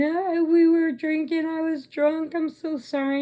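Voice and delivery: high, wailing mock pleading; hammed-up high apologetic wail; whiny apology. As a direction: imitate two guilty friends begging. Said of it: "they're both start going".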